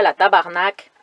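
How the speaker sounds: put-on Quebec French accent. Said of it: "Cet accent était absolument horrible".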